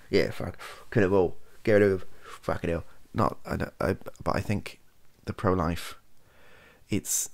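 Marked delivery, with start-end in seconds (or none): 0.1-2.8 loud, hammed-up "kill it all" voice; mock careless extremist. Caricaturing pro-choice extremes, a loud mock rant. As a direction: parody the position he rejects, loudly. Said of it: "I'm not saying".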